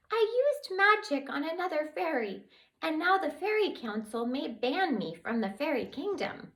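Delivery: high small voice; tiny fairy explaining her crime; worried tiny fairy. Acted.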